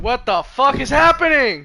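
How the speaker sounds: shocked shout at the screen; startled outburst. Real, not acted. Sudden message pops up on stream.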